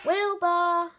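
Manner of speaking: high, loud call. A narrator's reading. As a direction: call out to someone hidden. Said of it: "called Elmer".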